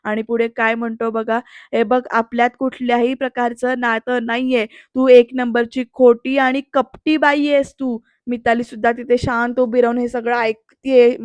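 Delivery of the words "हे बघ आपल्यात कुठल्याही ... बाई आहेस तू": usual speaking voice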